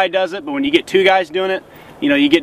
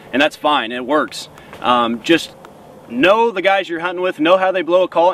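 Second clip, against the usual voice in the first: ordinary talk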